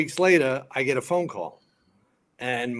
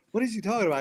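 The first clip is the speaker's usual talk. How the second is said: high-voiced imitation of a baffled woman; puzzled actress; 10 semitones higher, much faster than usual. Actress asks fireman about his friend's rant, puzzled. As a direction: lightly mimic her bewilderment, pitched up.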